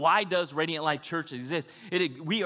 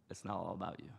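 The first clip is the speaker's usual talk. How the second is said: hushed line; 8 semitones lower, 13 dB quieter than usual. Shown as a demonstration. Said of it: "Say those five words".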